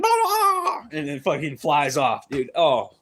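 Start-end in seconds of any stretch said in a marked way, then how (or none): 0.0-0.8 very high squawk broken by a laugh; bird squawk imitation, laughing. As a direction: squawk like the bird while cracking up. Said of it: "And the bird's like".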